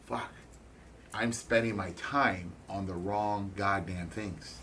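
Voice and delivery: slow, soft self-reproach; quiet realization. Real, not acted. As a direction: let the truth sink in slowly. Realization after being humbled.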